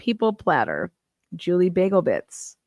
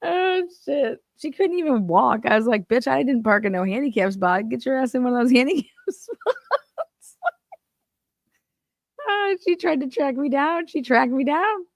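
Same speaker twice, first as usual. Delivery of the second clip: giggling through it; 8 semitones higher than usual. Real, not acted. Still laughing through the story.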